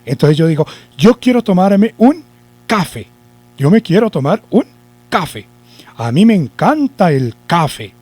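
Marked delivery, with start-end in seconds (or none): 1.0-3.1 café wrongly stressed on CA, high lead-in; acento mal puesto. Shown as a demonstration. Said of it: "que el acento lo lleva en K". 3.6-5.5 café wrongly stressed on its first syllable CA; acento mal puesto. Shown as a demonstration. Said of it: "que el acento lo lleva en K". 6.0-7.9 café mis-stressed on CA; acento mal puesto. Shown as a demonstration. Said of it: "¿Cierto que no?".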